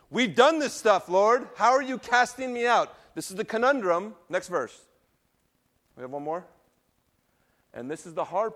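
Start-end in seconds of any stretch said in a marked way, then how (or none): 0.1-2.9 pleading voice. Acted. Voicing people pleading to the Lord.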